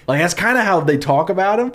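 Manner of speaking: ordinary talk, nothing marked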